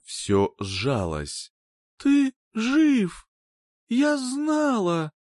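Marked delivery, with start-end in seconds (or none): 2.0-5.1 joyful disbelief. Acted. Rescued girl greets her rescuer.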